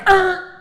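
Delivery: short loud high grunt of annoyance; annoyed growl. Acted. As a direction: bark out an irritated "Er!". Annoyed at jaywalkers.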